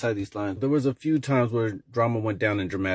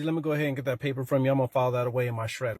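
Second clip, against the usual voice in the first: hurried teacher; 2 semitones higher, faster than usual. Acted. Teacher hurries to take the paper.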